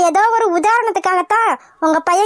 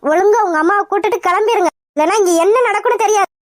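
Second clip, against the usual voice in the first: ordinary talk, nothing marked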